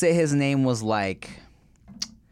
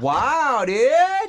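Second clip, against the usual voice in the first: much higher, loud rising wow dude; hammed-up shocked gasp of a reply; mock outrage; 14 semitones higher, 5 dB louder than usual. Pretending to be scandalized by a co-host, much higher and louder.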